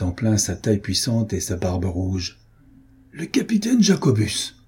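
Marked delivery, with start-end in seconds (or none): 3.1-4.6 high-pitched cry of recognition; exclaimed. A narrator's reading. Gentleman recognising an old enemy, high surprised exclamation. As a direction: exclaim the name in surprise, falling at the end. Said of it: "s'écria monsieur de la Guerche".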